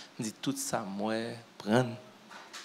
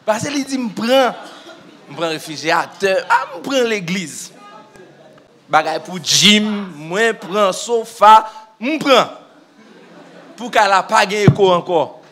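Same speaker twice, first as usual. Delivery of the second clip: much higher and louder, laughing through the words; laughing excitement; 6 semitones higher, 10 dB louder than usual. Real, not acted. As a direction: laugh it out with joy. Retelling joyful receiving of gifts.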